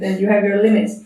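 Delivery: ordinary talk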